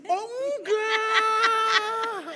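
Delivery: very high, loud, stretched-out laugh on "Oh enggak"; laughing denial. Retold or imitated. Husband retelling his answer to his wife: high, drawn-out laughing denial. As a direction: drag it out high, half shouting through laughter. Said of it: "Saya ngomong gini".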